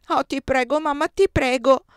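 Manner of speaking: put-on a little boy pleading with all his heart; child begging mother. A narrator's reading.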